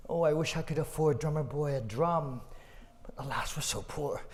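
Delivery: soft, sighing mother; wistful sigh. Acted. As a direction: murmur it to herself, defeated. Mother sighs alone.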